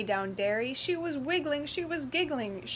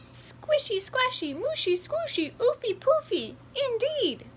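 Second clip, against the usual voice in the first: much higher, squeaky piglet; little piggy squealing over the mud; 12 semitones higher than usual. Acted. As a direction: squeal with delight.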